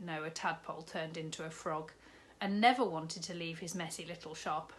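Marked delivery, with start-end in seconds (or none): none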